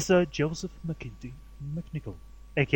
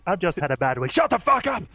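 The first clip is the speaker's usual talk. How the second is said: angry outburst; 5 dB louder than usual. Acted. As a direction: snap at him like a stressed star. Acting out the angry rant.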